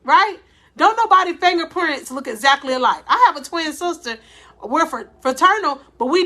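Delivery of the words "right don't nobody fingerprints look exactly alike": ordinary talk, nothing marked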